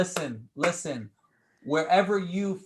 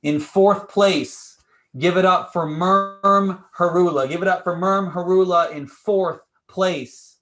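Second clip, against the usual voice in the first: loud, stretched award announcement; hype announcer; 8 dB louder, slower than usual. Acted. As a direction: call out the placing like a game-show host. Host announces fourth place.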